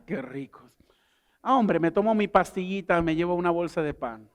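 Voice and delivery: ordinary talk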